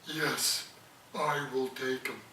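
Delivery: soft, slow whale voice; the whale brother answering his sister, soft and slow. Acted. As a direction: answer softly as the great whale. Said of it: "he talked like that because he was a whale".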